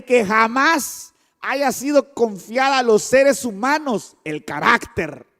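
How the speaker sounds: loud, slow emphatic preaching. Acted. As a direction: preach it out with full force. Preacher building to full force. (predicación enfática y lenta)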